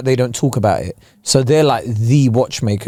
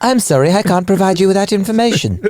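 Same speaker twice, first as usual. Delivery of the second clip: higher-pitched mock voice assistant; Siri; 6 semitones higher than usual. Phone assistant refuses to tell the time, imitated assistant voice. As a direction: answer as an apologetic digital assistant, pitched up. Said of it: "hey Siri, what's the time?".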